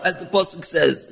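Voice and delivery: ordinary talk, nothing marked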